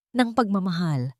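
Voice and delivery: usual speaking voice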